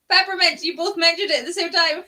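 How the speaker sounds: high, bright burst of delight; excited. Real, not acted. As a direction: squeal it out excitedly. Excited reaction to both saying.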